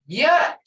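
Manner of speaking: YET loud and high, set apart; stress on YET. Shown as a demonstration. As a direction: punch Yet alone, then pause. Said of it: "But look here".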